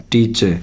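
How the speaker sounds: टीचर as "tee-che", R dropped; silent final R. Shown as a demonstration. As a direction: end टीचर on a falling "eh". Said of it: "आर का उच्चारण नहीं होगा".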